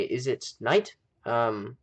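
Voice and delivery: usual speaking voice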